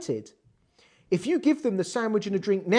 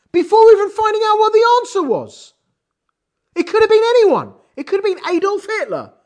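much louder and higher, "before even finding out" driven hard; emphatic exclamation; 10 semitones higher, 8 dB louder than usual. Real, not acted. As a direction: exclaim it as an outraged teacher. Teacher exclaims at the puzzle.

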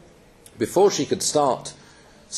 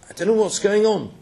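bewildered, upset; 8 semitones higher than usual. Retold or imitated. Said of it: "She says".

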